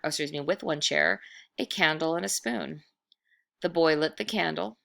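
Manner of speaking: plain reading voice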